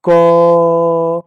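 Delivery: क said alone, loud and drawn out so its final अ rings; loud sounded letter. Shown as a demonstration. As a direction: sound out क loudly with a clear trailing अ. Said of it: "जैसे आप बोलो". (क को ज़ोर से बोलें, अंत में अ साफ़ सुनाई दे)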